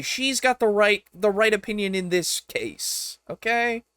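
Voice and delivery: animated insistence. Real, not acted. To viewers, pressing them high-pitched to listen to Jenny D. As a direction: keep pushing them to agree. Still arguing for the earlier video.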